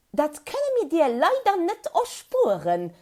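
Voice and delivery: high, loud chirping bird voice; a small bird peeping back down from the tree; squeaky birdlike answer; chirpy little bird. A narrator's reading. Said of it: "zurückgepiepst".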